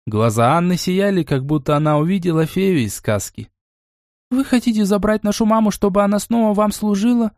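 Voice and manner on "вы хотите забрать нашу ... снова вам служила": fearful. Acted. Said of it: "со страхом спросила младшая девочка".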